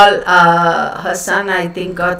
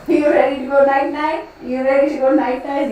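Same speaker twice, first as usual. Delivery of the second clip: higher, soft cooing to a pet; 5 semitones higher, 3 dB quieter than usual. Real, not acted. Owner asking her dog about bedtime, soft cooing. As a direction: coo gently at the sleepy dog.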